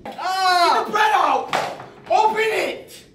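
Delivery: screaming Gordon Ramsay-style orders; furious chef. Acted. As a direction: bark orders at panicking cooks. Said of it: "Chef Ramsay yelled at me".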